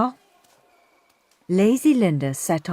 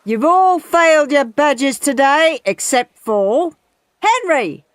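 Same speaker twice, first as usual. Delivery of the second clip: loud coach announcement; 7 semitones higher, 5 dB louder than usual. A narrator's reading.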